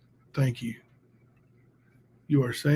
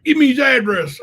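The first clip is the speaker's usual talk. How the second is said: louder, higher barked demand; 9 semitones higher, 8 dB louder than usual. Retold or imitated. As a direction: demand it loudly. Quoting demand in argument.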